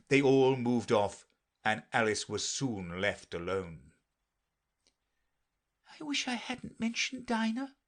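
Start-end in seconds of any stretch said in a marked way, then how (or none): 6.0-7.8 put-on high, wistful girl's voice; melancholy. A narrator's reading. Alice left alone, talking to herself, melancholy. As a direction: lament it in a high voice. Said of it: "in a melancholy tone".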